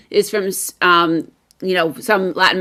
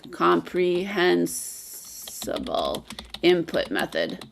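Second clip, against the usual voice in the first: dictating while typing; 2 semitones lower, 9 dB quieter, much slower than usual. Real, not acted. Host typing the method name into the chat, slow dictation. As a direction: say it piece by piece, softly, as you type.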